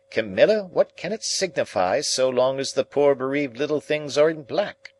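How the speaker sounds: fussy lady voice. Retold or imitated. Camilla quotes Matthew.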